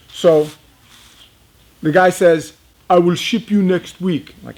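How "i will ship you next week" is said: put-on African accent. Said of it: "very thick African accent".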